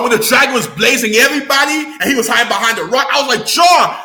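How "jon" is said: loud, high shout of a name; exasperated calling out. Retold or imitated. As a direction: yell at the screen hero. Retelling calling out to Jon.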